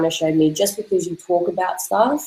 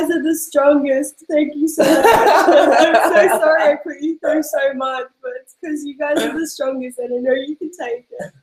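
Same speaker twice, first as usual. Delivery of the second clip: tearful laughing gratitude; 9 semitones higher than usual. Retold or imitated. Talking to her legs, high and laughing.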